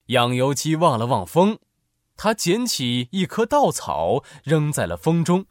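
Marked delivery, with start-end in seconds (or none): none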